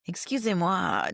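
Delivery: lightly put-on French teacher talk; French phrase. Teacher startling students with French, imitated French. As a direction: toss out a bit of French. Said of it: "speak a little bit in French".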